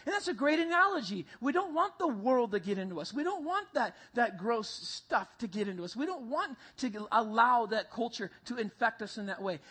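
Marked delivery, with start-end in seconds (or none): none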